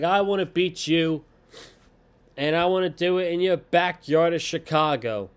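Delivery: imitated combative wrestler; mock challenge. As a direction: throw down a challenge like a promo. Imitating Becky's challenge to her rival.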